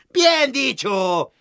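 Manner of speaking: voz mucho más aguda y lenta; grítalo alargando, con aprobación; forge's cry. Acted. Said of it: "grita la fragua". (la fragua grita aprobando, aguda y lenta)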